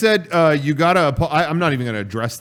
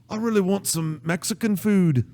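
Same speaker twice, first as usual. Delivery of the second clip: higher-pitched put-on Scottish voice; mock Scottish accent; 7 semitones higher than usual. Quoting a hungry Succession character, mock Scottish accent. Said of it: "he doesn't talk like that".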